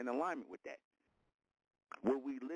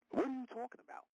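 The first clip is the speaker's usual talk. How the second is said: incredulous; 13 semitones higher than usual. Real, not acted. Repeats retort, far higher.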